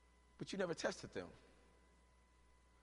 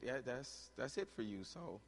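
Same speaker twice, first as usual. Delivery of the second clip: mock hopeless doctor; 5 semitones lower, 8 dB quieter than usual. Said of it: "and he said".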